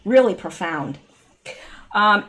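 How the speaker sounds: normal talking voice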